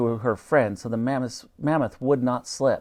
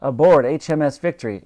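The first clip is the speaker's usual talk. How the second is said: plain reading voice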